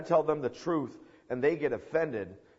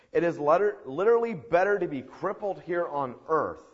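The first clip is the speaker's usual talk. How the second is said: loud, higher, hammered-home point; raised-voice insistence from the pulpit; emphatic preaching; 7 semitones higher, 8 dB louder than usual. Real, not acted. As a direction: preach it out forcefully.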